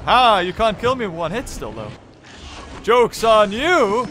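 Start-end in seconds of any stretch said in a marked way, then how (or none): 2.8-4.1 slow, loud gloating taunt. Pretend. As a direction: rub it in like a villain. Taunting the game enemy.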